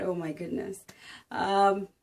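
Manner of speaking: ordinary talk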